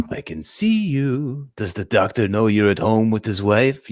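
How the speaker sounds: slow, drawn-out menacing call; villain's phone voice. Retold or imitated. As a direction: imitate the villain taunting down the phone.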